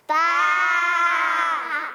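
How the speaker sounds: a loud, sung baa like a sheep; loud sheep bleat. Acted. Reading animal sounds to children.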